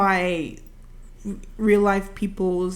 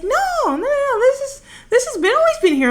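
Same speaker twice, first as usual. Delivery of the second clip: much higher, fast mock-insistent denial; squeaky protesting friend; 3 dB louder, faster than usual. Best friend insisting to Terry, in a high squeaky voice. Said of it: "And she was like".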